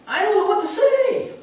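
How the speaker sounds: put-on high, small timid child voice; lightly voiced shy daughter; timid little girl. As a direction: shrink into a shy little girl's plea. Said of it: "a little timid and shy".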